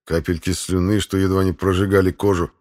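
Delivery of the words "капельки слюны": ordinary delivery, nothing marked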